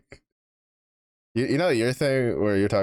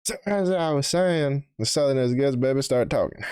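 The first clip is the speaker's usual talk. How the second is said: put-on Southern accent; 7 semitones higher than usual. Hamming it up for friends, put-on Southern accent. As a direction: play up a Southern accent, pitched high.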